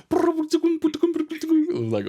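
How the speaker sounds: high, rapid sputter of falling bottles; rattling bottle noises. Retold or imitated. Imitating bottles hitting the ground with high spluttered noises. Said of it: "all these bottles hitting the ground".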